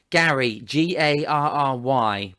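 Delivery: slow, letter by letter; spelling slowly for a toddler. Shown as a demonstration. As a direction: spell it out like teaching a child. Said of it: "teaching her the alphabet".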